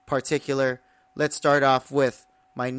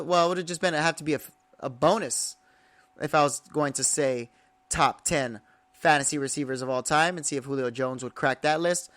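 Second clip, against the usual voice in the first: normal talking voice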